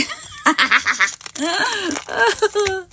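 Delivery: a long breathy laugh at herself; airy laugh. Real, not acted. Crafter amused by her own card, long airy laugh. Laughing after reading sentiment.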